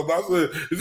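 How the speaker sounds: ordinary talk, nothing marked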